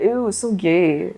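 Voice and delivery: slow, drawn-out mock sneer; mock disgust. Guys jeering at anything unmanly, mock disgust. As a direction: stretch the ew like a jeering guy. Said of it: "You'd be like".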